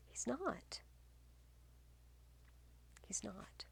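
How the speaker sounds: whispered aside to students. Real, not acted. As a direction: hush it like a secret. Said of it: "teacher whispers point".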